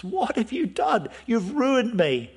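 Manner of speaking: a father cries out in horror at what his sons did; appalled outcry. Retold or imitated. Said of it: "is absolutely appalled".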